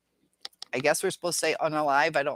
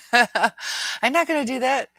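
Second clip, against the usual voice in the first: laughing; 8 semitones higher, 5 dB louder, slower than usual. Real, not acted. Laughing reply to chat teasing.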